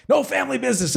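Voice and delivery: very high mock reprimand; playful impression of a wife laying down the rule; scolding wife. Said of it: "my wife will go".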